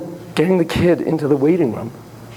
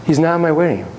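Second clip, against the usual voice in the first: usual speaking voice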